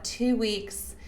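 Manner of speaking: normal talking voice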